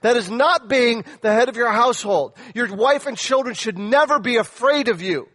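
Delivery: loud, high, driving pulpit preaching; preaching at full force. Acted. Pastor warning husbands, preaching at full force. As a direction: hammer it home like a fiery preacher.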